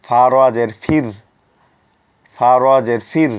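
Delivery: loud recitation, "ফির" with a light thin r. Shown as a demonstration. Teacher reciting aloud for students, thin r loud. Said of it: "চিকন বা পাতলা করে পড়তে হবে".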